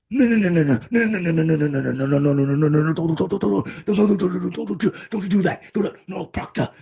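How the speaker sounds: high, frantic pleading no's and don'ts; panicked, begging, squeaky rapid pleading; Danny Glover impression. Host shows his Danny Glover bit from Pure Luck, frantic high pleading. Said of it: "my Danny Glover impression".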